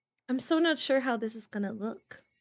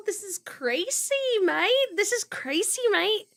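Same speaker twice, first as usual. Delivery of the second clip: lightly put-on Australian accent, higher; 10 semitones higher than usual. Streamer testing an Australian accent, mock Australian. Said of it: "is that a good Australian accent".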